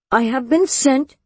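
plain reading voice

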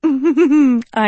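short amused laugh; chuckle. A narrator's reading. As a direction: let out a little chuckle. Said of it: "Sophie chuckled".

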